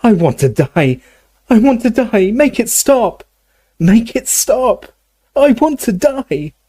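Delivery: high, wailing cries, slowing at the end; anguished scream. A narrator's reading. Dumbledore in anguish after the potion, high screamed wailing. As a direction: scream it high in agony, climbing. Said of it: "began to scream in".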